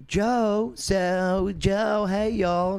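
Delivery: lightly put-on Southern drawl calling Joe; Southern accent. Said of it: "Very Southern".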